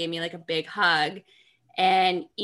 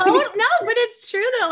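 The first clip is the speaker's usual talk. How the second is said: high, louder laughing reply; 7 dB louder than usual. Real, not acted. Cohost laughs at the boy's rant, high laughing reply. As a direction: laugh through the words.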